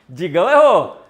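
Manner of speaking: loud laughing jab; laughing tease. Real, not acted. Host laughing at viewer Digão's wrong answer, teasing loudly. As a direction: laugh it out at his mistake.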